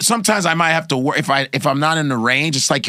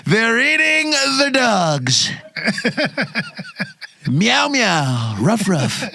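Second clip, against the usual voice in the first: high, very slow Trump voice with animal noises; mock Trump barking meow and ruff; drawn-out Trump with silly animal sounds; Trump impression; 8 semitones higher, much slower than usual. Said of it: "that was the funniest shit".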